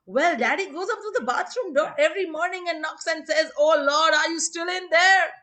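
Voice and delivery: put-on high child's voice, building to a loud cry; little Tommy. Tommy explains his answer to the teacher, high, building voice. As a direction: pipe up innocently, then call out Daddy's words loudly. Tommy's punchline, quoting shouting father.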